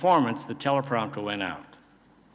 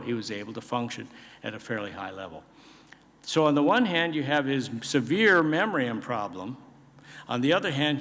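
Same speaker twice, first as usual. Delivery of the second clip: usual speaking voice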